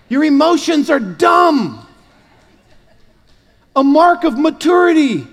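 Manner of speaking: high, loud, laughing sermon shout; shouting preacher. Acted.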